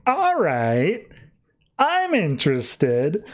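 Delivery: high, drawn-out show of interest; intrigued. Retold or imitated. Voices an intrigued villain's reply.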